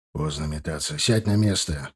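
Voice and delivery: low, firm and stern; unusually stern. Acted. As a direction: order her to sit down. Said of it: "непривычно строго сказал ей муж".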